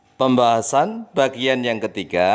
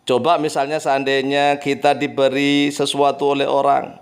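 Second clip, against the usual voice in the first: ordinary talk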